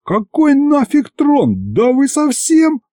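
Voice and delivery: very high, slow panicked shouting; panicked protest. A narrator's reading. Hero panicking over the throne.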